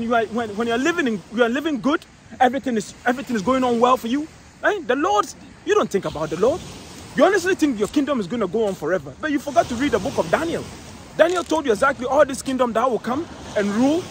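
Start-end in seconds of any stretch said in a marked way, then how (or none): none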